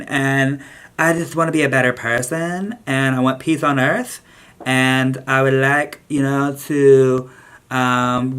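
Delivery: slow, airy Valley Girl mocking resolution-makers. Vlogger mocks people making New Year's resolutions, slow Valley Girl voice. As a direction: drag it out like a ditzy Valley Girl listing goals. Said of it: "channeling my Valley Girl voice".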